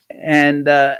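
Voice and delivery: ordinary delivery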